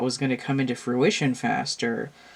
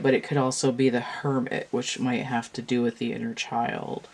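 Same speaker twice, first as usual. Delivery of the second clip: ordinary delivery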